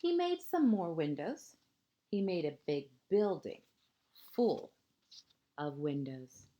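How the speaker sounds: lilting picture-book narration, phrase ends hushed; reading to children. A narrator's reading. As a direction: read it like a bedtime story to a small child.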